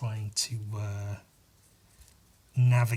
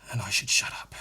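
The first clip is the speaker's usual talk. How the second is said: whispered to self; 4 semitones lower than usual. Real, not acted.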